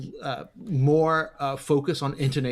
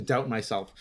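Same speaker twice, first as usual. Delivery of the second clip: usual speaking voice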